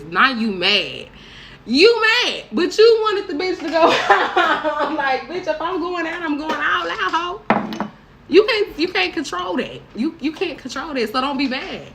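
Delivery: high, loud laughing rant; excited rant. Real, not acted. Ranting at friends, laughing.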